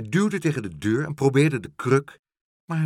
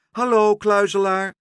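high, loud hail; insistent raised call; 8 semitones higher, 7 dB louder than usual. Acted. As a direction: shout up to someone in hiding. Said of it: "riep Pluk".

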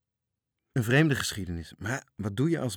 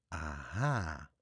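lower, softer murmur of discovery; little captain realising it is a pirate ship; low knowing mutter; 5 semitones lower, 6 dB quieter than usual. A narrator's reading. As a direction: murmur it to yourself as it dawns. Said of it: "mompelde de kleine kapitein".

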